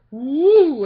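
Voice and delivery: loud swooping woo; drawn-out loud whoosh noise; imitated hair whoosh. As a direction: make a playful swoosh sound. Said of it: "where hers just says".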